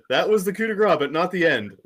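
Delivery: "That was the coup de grace" shouted high and loud; hammed-up furious declaration; mock-furious fan ranting; angry reading. Shown as a demonstration. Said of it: "read it from an angry point of view".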